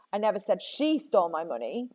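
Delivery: single out "she"; "she" carries the main stress. Shown as a demonstration. Teacher shows students how meaning shifts, stressing "she". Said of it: "where on earth you put the emphasis".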